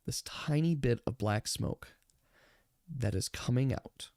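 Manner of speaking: eerie, quiet game master narration; hushed suspense narration. A narrator's reading. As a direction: draw them in with a hushed voice. GM narrates eerie silent moment.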